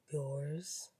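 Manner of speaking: low, soft, mocking question; poised rival, quietly scornful; low drawl. A narrator's reading. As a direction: toss it back softly in a low drawl. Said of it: "said in that low drawl".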